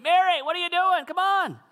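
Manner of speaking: high, loud impatient yell; hammed-up angry call across a yard; mock yell. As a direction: shout her name like a scolding. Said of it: "I don't think Jesus yelled at her".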